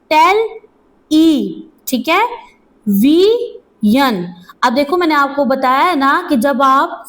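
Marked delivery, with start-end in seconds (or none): 0.1-1.6 टेल, then ई, each standing alone after a pause; teacher sounding out टेल and ई separately; syllable by syllable. Shown as a demonstration. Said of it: "किस तरह से, देखो". 2.9-4.3 teacher sounding out वी and यन separately; syllable by syllable. Shown as a demonstration. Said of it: "किस तरह से, देखो".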